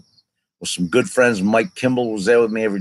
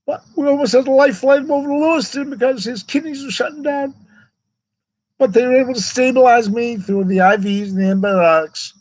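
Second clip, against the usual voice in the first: high, strained voice near tears; crying while telling; 14 semitones higher than usual. Real, not acted. Recalling nearly losing his kidneys, voice breaking. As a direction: push on through real crying. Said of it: "it's just tough to remember these things".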